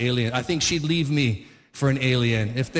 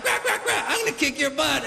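very high, frantic quacks into a comic threat; mock duck picking a fight; threatening big duck; 10 semitones higher than usual. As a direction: play an enraged duck, pitched way up. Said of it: "Then the big one's like".

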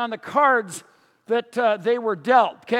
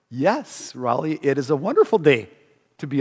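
calm neighbor's reply; 7 semitones lower than usual. Retold or imitated. Voicing blind neighbor's cheerful reply.

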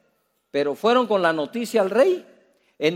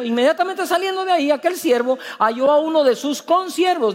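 ordinary talk

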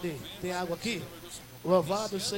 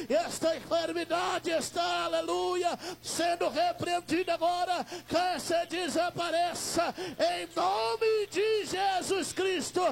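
fervent healing prayer; 6 semitones higher than usual. Acted. Pastor shouting a prayer of healing over a sick church member. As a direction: cry out over the sick man, casting out the illness.

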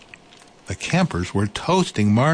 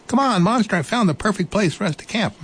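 mouse calling out; 12 semitones higher, faster than usual. Acted. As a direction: call out brightly to Monster as the mouse. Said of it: "Mouse called".

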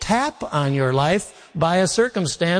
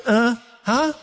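higher questioning huh, imitating a kid; feigned not hearing; 5 semitones higher than usual. Son asked to take out the garbage, feigned not hearing. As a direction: play at not having heard mom. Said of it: "I'd always say to my mom".

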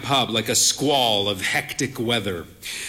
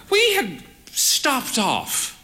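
put-on higher, slow voice of a proud frail old lady; frail old mother; 10 semitones higher, slower than usual. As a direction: play the bird-faced old mother, cautious. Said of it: "she said".

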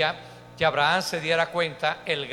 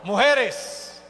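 loud, high count-in for the crowd; high-pitched, full-voiced crowd call; rallying shout; 9 semitones higher, 7 dB louder, slower than usual. Real, not acted. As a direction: shout the cue like a rally leader. Cueing women and men to shout.

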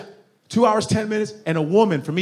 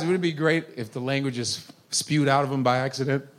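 ordinary talk, nothing marked

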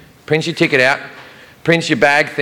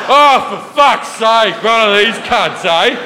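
exasperated mock outburst, higher and drawn out; losing it; 6 semitones higher, slower than usual. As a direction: imitate fed-up Australians losing their patience. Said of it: "they're losing their fucking shit".

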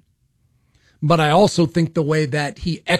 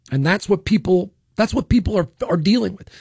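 normal talking voice

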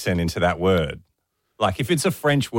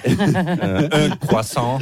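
French accent; 3 semitones higher, much slower than usual. Shown as a demonstration. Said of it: "do it how you did it this morning".

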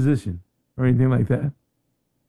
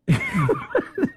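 sudden squeaky burst of laughter; high-pitched laugh. Real, not acted. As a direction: break into a high cackle. Amused at the song name.

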